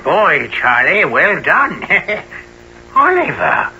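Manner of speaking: high, manic cackling greeting; crazed high voice with a giggle; raving madman. Acted. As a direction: gloat like a man lost in delusion. Raving, greeting imaginary boys.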